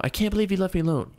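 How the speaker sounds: high, fast mocking girlfriend voice; fast high parody of his girlfriend; mock girlfriend whine. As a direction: rattle it off like a mocking whiny girlfriend.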